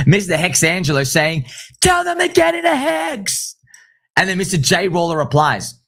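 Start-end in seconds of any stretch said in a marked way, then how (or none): 1.8-3.6 much higher, slow mock bellow; hammed-up rallying cry, stretched out; mock shout. Imitating Mister Hexangelo's call with a hammed-up high shout.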